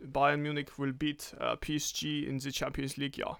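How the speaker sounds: predict the result like a German pundit; mock German accent. Co-host predicting Bayern in a German accent. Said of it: "This is the German".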